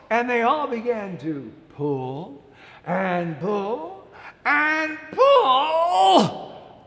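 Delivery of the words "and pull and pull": very slow, loud effortful yell; straining shout. A narrator's reading. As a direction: heave with everything. Straining to pull turnip.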